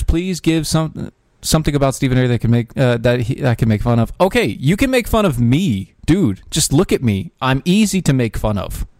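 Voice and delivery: ordinary talk, nothing marked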